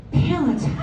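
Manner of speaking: Palatine as the sister says it, lifted and showy; mock-posh Palatine; pompous Palatine. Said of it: "like, pompous".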